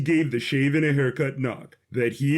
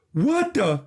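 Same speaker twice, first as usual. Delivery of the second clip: shocked outburst; 8 semitones higher than usual. Acted. Jesse shocked at wedding banner.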